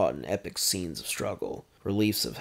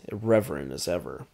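ordinary delivery

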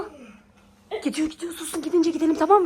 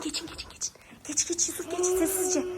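unvoiced hurried whisper; whispering while hiding; 8 dB quieter than usual. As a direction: whisper so the zombie can't hear. Sneaking past the zombie.

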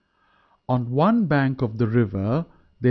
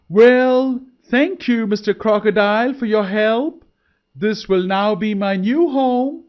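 much higher, loud gloating thanks; triumphant deer; 8 semitones higher, 6 dB louder than usual. A narrator's reading. Deer mocking the crocodile after tricking him, high and triumphant. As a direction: crow your victory from the far bank.

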